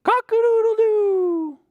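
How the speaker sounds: high, loud, slow cock-a-doodle-doo; a stretched-out crow for kids to copy; rooster crow demo. Shown as a demonstration. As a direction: show the toddlers how the rooster crows. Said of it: "Can you say that?".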